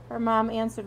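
plain reading voice

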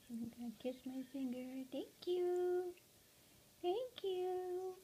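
high whispered thank you; whispered coaxing. Real, not acted. Owner coaxing her parrot to kiss her finger, high whisper. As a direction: coax the bird softly.

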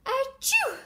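loud, high "achoo"; acted sneeze. Teacher acting the sneeze that gives the hiker away, mock sneeze. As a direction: burst out with a pretend sneeze. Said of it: "such as a sneeze".

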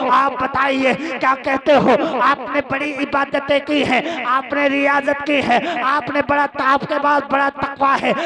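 urgent rhetorical questions building to shouts; full-force preaching. Acted. (पूरे जोश में तक़रीर)

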